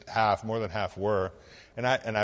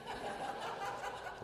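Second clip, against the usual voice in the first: quiet laugh at his own joke; a little breathless laugh at the punchline; soft breathy laugh; 16 dB quieter than usual. Real, not acted. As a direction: let out a hushed chuckle.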